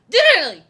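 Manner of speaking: loud, high, mangled shout of Literally; garbled Harry shout. Retold or imitated. Harry throwing floo powder, shouting a garbled Literally. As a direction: yell Literally with the word slurring apart. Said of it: "he's like".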